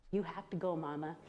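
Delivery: soft and hushed, fading at the end; whispered. Retold or imitated. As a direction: hush it like a child into his mom's ear. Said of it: "and whispers".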